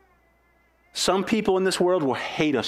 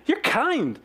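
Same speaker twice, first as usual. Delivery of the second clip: higher mock-stunned repeat; shocked worker; 9 semitones higher than usual. Preacher re-quotes the worker, mock-amazed and higher. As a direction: echo him, higher and amazed. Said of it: "That's what he said".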